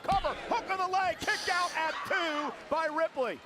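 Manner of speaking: wrestling commentator. Real, not acted. Live play-by-play of near fall.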